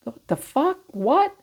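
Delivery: imitating someone floored by the news; stunned disbelief. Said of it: "you're like".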